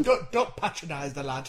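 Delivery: ordinary talk, nothing marked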